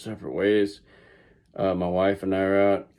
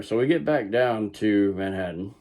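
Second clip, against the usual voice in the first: normal talking voice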